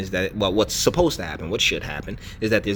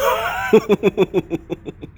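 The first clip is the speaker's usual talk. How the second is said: a big real laugh, higher and louder; hearty laugh; 9 semitones higher, 5 dB louder than usual. Real, not acted. Host cracking up at the joke he just quoted, hearty laugh. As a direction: let the laugh burst out after the punchline.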